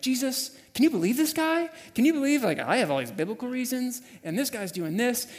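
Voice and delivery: exasperated venting prayer. Retold or imitated. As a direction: let off steam, voice rising. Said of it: "by praying, I just mean venting".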